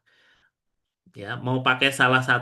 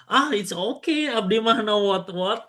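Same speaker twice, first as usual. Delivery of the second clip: high, breezy reply in a put-on voice; mock casual reply; 9 semitones higher than usual. Shown as a demonstration. Teacher acting a carefree reply to thanks, high and breezy. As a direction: toss off the reply lightly, pitched up.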